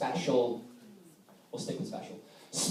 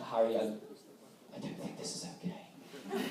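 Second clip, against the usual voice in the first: nervous laughing murmur; 5 semitones lower, 13 dB quieter than usual. Retold or imitated.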